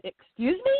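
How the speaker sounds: high, startled excuse me; shocked disbelief. Retold or imitated. Woman hearing the dog's name, shocked. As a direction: squeak it out in disbelief.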